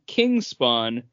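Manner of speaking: ordinary talk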